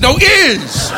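IS pitched high and drawn out; emphatic correction. Retold or imitated. President correcting the prosecutor, IS stressed high. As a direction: hit "is" high and long.

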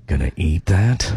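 very low, slow seductive question; flirty. Pretend. Co-host on a hot date, flirty. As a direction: drop deep and linger on it.

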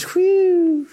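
high, loud sigh of relief; relieved whew. Retold or imitated. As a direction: blow it out like you just survived. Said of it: "everyone goes".

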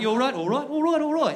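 high-pitched British chirp, repeated; British greeting. Acted. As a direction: toss it off like passing Brits. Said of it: "It's just a common".

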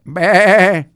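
loud bleated go; bleating sheep. Acted. The sheep tell the grandfather to go, loud bleat.

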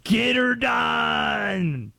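slow, loud Southern drawl shout; Southern accent. Retold or imitated. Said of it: "easiest one to do".

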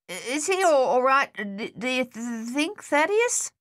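high, halting stutter; stammering worried question. A narrator's reading. Luther asking whether his hurt brother is all right, stammering. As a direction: stammer on the sounds, climbing high at the end.

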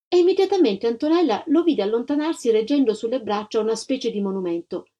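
ordinary delivery

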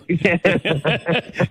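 forced high chuckle on cue; demo fake laugh. Shown as a demonstration. Comic showing the host his fake laugh. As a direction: show off a put-on laugh. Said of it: "here's mine".

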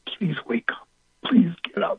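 soft, high, crying whimper; tearful plea. Retold or imitated. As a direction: beg softly through tears. Pleading with dead mother at casket.

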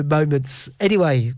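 ordinary talk, nothing marked

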